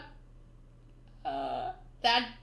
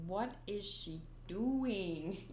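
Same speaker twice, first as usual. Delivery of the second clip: low, slow, softer imagined bystander; puzzled passer-by; 5 semitones lower, 7 dB quieter, much slower than usual. Pretend. Passer-by overhearing her barking, baffled. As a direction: drag it out in baffled disbelief. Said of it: "they're gonna be like".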